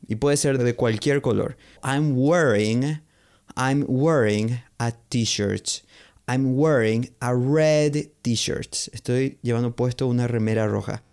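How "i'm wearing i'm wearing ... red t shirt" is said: slow, careful model sentences; slow teaching demo. Shown as a demonstration. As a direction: spell it out slowly for learners. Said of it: "teacher models English phrases".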